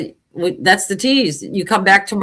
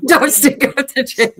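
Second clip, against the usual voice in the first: slow, amused echo through a laugh; 5 semitones higher, slower than usual. Retold or imitated. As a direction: repeat it slowly while laughing. Co-host echoes the scolding.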